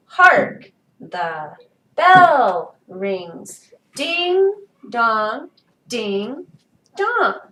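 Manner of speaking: "hark" and "bell" punched loud, "the" and "rings" light; measured word by word, hitting "hark", "bell" and "Ding"; stressed reading. Shown as a demonstration. Teacher reading the marked board, stressing "hark" and "bell". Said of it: "on which we are to lay the stress".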